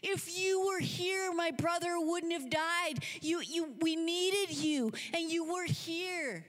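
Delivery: high, drawn-out anguished reproach; Mary. Acted. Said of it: "getting a little more excited".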